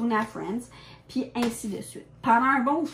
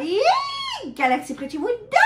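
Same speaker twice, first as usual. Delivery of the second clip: excited announcement; 10 semitones higher than usual. Acted. Announcing the sequel title.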